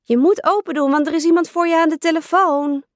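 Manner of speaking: a hint of a whiny child's shout; insistent calling. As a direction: rush it out, pushing her to open up. Sister keeps shouting at door.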